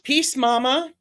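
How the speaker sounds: high, bright call of the name. Shown as a demonstration. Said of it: "I'm gonna whisper this into the microphone".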